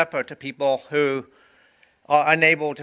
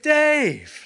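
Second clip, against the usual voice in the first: a light, much higher imitation of Greg greeting; imitating Greg; 12 semitones higher, 3 dB louder than usual. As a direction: call the name high the way Greg says it. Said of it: "That's how he says it".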